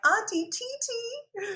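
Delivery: high, slow, sing-song call; child calling out. Retold or imitated. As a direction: call out sweetly like a little girl. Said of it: "They're like".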